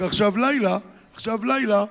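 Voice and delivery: ordinary talk, nothing marked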